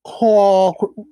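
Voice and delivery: exaggerated mock British accent, sent up for laughs; bad British impression. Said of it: "my impression is awful".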